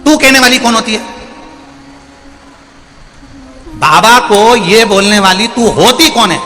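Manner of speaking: angry scolding. Acted. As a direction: tear into her for turning the monk away. Mother-in-law scolds daughter-in-law.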